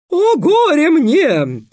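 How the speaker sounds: very high, loud and slow breaking lament; cracking falsetto. Acted. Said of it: "срывающимся фальцетом воскликнул Песя". (срывающийся фальцет)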